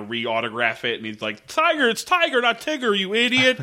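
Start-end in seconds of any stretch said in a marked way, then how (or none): 1.5-3.6 high, mock exasperated correction; hammed-up correction of an idiot; exasperated scolding. Voicing exasperated character.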